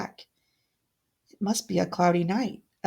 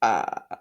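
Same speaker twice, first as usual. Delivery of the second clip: deep short evil chuckle; cruel low laugh; 12 semitones lower than usual. Acted. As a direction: chuckle cruelly as the door shuts. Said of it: "Odette's cruel laugh".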